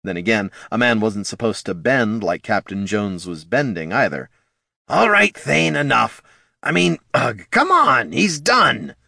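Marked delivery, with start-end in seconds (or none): 4.9-9.0 high, louder kobold, rising in alarm; kobold pleading. A narrator's reading. Bert urging Thane to stop killing the assassin. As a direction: pull the raging troll off, queasy.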